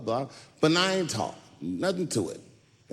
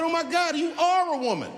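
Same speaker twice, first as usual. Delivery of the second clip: much higher, shocked; astonished realization; 12 semitones higher than usual. Retold or imitated. Comedian realizing his opponent is a woman, shocked and high. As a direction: gasp it out in shock. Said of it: "I said".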